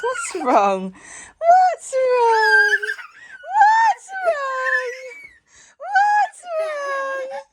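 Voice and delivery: very high, loud playful squeal; high squealy baby talk. Acted. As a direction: squeal it like teasing a toddler. Mum teases crying toddler.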